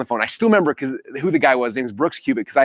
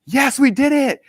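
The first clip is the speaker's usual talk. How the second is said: excited cheer; 11 semitones higher, slower than usual. Real, not acted. Celebrating a win on camera.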